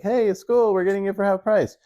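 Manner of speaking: high and a bit fast, breezy; mock excited self. Husband cheerfully reassuring his wife about half price, high and breezy. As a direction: toss it off, delighted with the deal.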